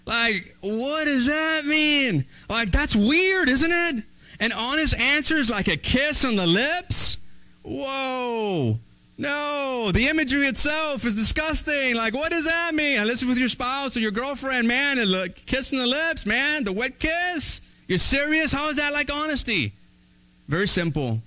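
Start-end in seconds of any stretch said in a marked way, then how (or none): 0.1-4.0 high-pitched, drawn-out disbelief; mock bafflement. Baffled listener reacting to the kiss proverb, mock bafflement. 4.4-7.2 high, questioning rise in disbelief; incredulous echo. Acted. Baffled listener repeating the proverb back, incredulous echo. 7.6-19.7 high-pitched, grossed-out protest; mock disbelief. Listener protesting the wet kiss imagery, mock disbelief.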